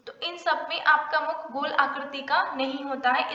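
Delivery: ordinary talk, nothing marked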